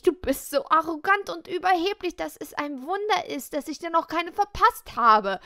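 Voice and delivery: loud, high, furious tirade; venting anger. Acted. Girl unloads her fury on the smug boy, loud and high. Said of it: "Ließ ich meiner Wut Luft".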